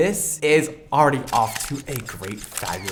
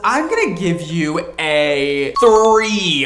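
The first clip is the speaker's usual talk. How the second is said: game show announcer; 8 semitones higher, 7 dB louder, slower than usual. Pretend. Announcing a score.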